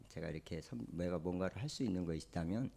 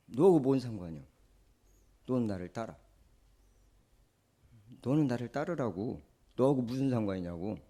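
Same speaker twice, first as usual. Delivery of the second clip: snubbing rebuke; 3 semitones higher, 5 dB louder than usual. Acted. Jesus snapping at Peter over his question, a snubbing rebuke. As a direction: cut him off with a sharp snub. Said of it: "면박 주듯이".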